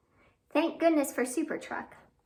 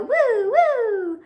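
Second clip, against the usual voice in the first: high, loud playful woo woo; 10 semitones higher, 11 dB louder than usual. Acted. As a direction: whoop like a spinning yo-yo. Yo-yo noises in the rhyme.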